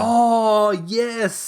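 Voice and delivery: loud, high, drawn-out realisation; real burst of recognition; sudden recognition. Real, not acted. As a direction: exclaim as the name clicks.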